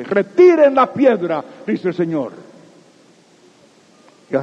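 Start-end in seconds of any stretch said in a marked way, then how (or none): 0.0-1.4 loud, high and slow command; commanding order. Acted. Jesus ordering the stone removed, slow loud command. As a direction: order the stone rolled away.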